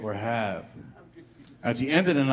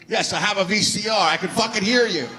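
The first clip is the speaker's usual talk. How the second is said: exasperated retort; 8 semitones higher than usual. Acted. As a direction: snap back at the pushy mailman. Ranting at the mailman.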